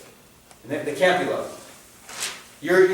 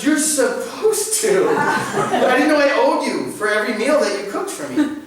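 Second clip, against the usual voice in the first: laughing retort; 11 semitones higher, 5 dB louder than usual. Acted. Retort to guilt-tripping mom.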